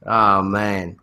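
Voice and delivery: ordinary talk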